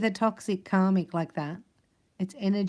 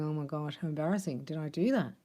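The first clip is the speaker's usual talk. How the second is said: lower, softer embarrassed mumble; mock mumbling; 4 semitones lower, 7 dB quieter than usual. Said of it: "are you mumbling".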